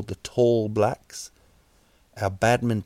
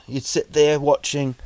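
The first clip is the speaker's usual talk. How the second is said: ordinary talk, nothing marked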